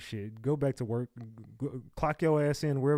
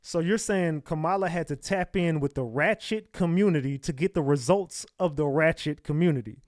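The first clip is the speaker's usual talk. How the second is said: usual speaking voice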